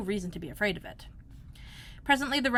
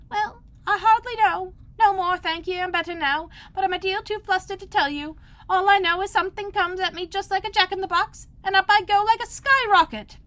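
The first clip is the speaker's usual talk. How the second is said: feeble squeaking voice; 11 semitones higher, 7 dB louder than usual. A narrator's reading. Bill telling the crowd what happened, high squeaky. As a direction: squeak like a dazed little lizard. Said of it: "a little feeble squeaking voice".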